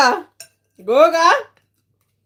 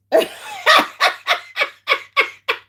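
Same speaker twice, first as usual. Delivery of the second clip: very high shriek of laughter; real helpless laughing shriek. Real, not acted. Laughing at a chat comment.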